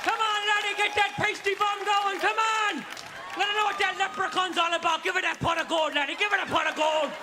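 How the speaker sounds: high, rowdy Irish brogue egging him on; Irish cheering ancestors. Acted. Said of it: "your ancestors cheering you on".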